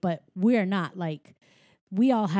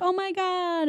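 high, soft excited gasp of joy; mock-overjoyed, high-pitched exclamation; happy reunion; 10 semitones higher, 4 dB quieter than usual. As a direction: act out delighted disbelief, pitch way up. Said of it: "celebrating and happy".

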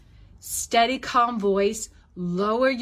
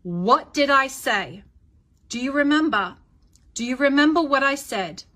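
firm, higher, emphatic questioning; stern parent; 4 semitones higher, 4 dB louder than usual. Shown as a demonstration. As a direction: press the child to remember, staying controlled. Parent firmly confronting screaming child.

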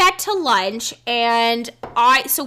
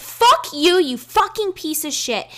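retold yell; 7 semitones higher than usual. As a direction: spit it at him. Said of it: "And I was like".

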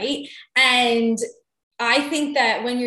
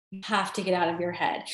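the same line, much softer and lower; softer repeat; 6 semitones lower, 7 dB quieter, faster than usual. Shown as a demonstration. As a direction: let the repeat drop away into a quiet echo.

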